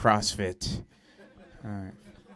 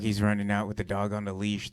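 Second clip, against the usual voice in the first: usual speaking voice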